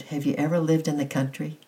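plain reading voice

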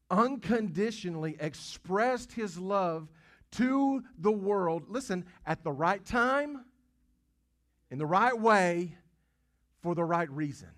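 preacher's rising declaration; emphatic preaching. Real, not acted. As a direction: drive each phrase home. Preaching climax.

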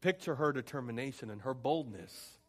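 normal talking voice

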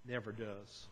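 ordinary delivery

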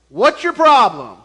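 loud mock telling-off; scolding. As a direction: imitate someone taking him to task. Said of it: "and just scold him".

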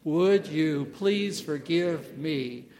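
a slow, firm choral repeat; slow group recitation. Shown as a demonstration. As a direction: lead the room through each word slowly. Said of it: "Say this out loud with me".

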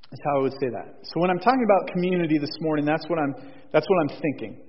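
usual speaking voice

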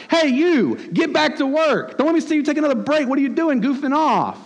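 high-pitched, indignant telling-off; boss's mock rebuke. Boss to employee on too many breaks, mock scolding. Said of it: "rebuke is what you do to that employee".

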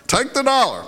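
mock parental yell. Parents to their daughter at the children's sermon, mock yell. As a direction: imitate a parent calling out an order. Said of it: "the parents yelled out".

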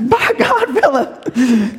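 hammed-up threat to Philip; mock threat. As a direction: threaten your buddy playfully. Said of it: "you threaten".

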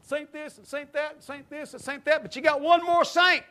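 high, building toward a loud punchline; working up a big crowd; preaching to crowds. Retold or imitated. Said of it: "And say".

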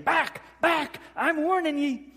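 high, loud panicky shout; loud high cornered villager's warning; frightened villager yell. Retold or imitated. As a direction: wave him off with a shrill threat. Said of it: "starts swinging a torch at Shrek, saying".

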